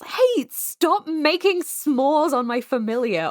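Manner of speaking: loud, high, drawn-out "hey, stop that" holler; indignant shout. Acted. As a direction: call out to kids messing with your familiar. Character calling out to kids.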